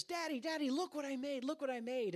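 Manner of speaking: put-on high-pitched eager child voice; high, pleading little-kid excitement; excited little boy. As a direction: imitate a small boy calling to his daddy. Little son showing dad his paper.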